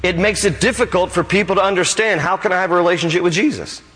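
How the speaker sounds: high, fervent preaching voice; full-force preaching. Acted. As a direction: drive the point home from the pulpit.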